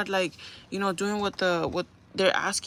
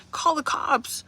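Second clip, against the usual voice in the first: higher, insistent order to viewers; urging viewers to act, pitched up; exasperated command; 7 semitones higher, much slower than usual. Real, not acted. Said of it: "What are you doing".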